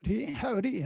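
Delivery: ordinary delivery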